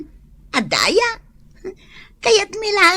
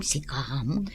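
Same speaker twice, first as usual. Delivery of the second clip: whispered aside; 13 dB quieter than usual. Acted. Aside under break time.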